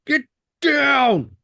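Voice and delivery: high-pitched strained yell; mock yell. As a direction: imitate a swamped adult yelling at kids. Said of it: "being like".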